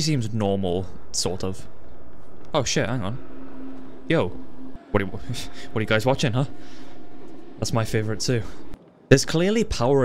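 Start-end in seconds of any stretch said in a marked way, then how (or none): none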